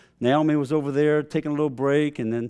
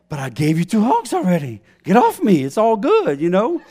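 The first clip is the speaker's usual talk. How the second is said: higher, louder mock exasperation; exasperated protest; 4 semitones higher, 4 dB louder than usual. Dad to his hugging daughter, mock exasperated protest. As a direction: fend off a clingy child, voice climbing. Acted dad fending off hugs.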